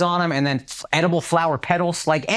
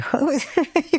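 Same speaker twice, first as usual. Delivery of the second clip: high giddy laugh; 13 semitones higher than usual. Retold or imitated. Giddy laugh before quote.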